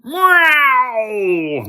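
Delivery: high, loud shrieking monster meow; unearthly scream. Acted. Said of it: "with an unearthly scream".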